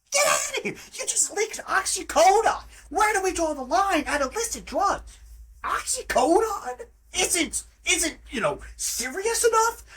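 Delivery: sarcastic falsetto parody of the agent; mocking high voice. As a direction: mimic them in a silly high pitch. Mocking the agency's logic.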